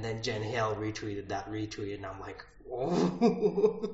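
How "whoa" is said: amazed whoa. Retold or imitated. Amazed at being retweeted.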